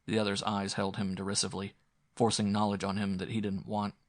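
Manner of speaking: usual speaking voice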